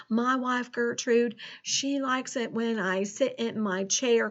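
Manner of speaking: put-on folksy rural American farmer accent; Farmer Ted's country accent. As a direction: chat to the kids in a hammed-up country farmer accent. Plays Farmer Ted throughout lesson.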